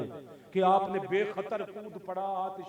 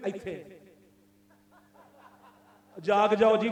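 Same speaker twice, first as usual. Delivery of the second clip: normal talking voice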